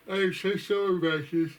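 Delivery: talking through a real yawn. Real, not acted. Apologizing to viewers mid-update, yawning. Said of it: "I'm sorry about this yawning".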